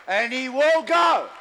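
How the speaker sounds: high, loud preacher's climax; fiery sermon shout; preaching at full force. Real, not acted. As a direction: drive it home to the church.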